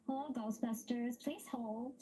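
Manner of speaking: secretary answering phone. Retold or imitated. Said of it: "a little higher than I'm talking now".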